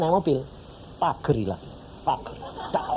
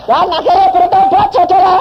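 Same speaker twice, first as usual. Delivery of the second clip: high, loud, laughing proclamation; laughing triumphantly to the congregation; 14 semitones higher, 6 dB louder than usual. Real, not acted. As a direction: burst out laughing as you announce it. Preacher laughs into punchline.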